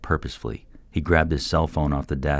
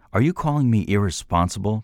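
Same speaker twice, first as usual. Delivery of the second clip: shooting back; 6 semitones higher, 3 dB louder than usual. A narrator's reading. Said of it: "Troy shot back".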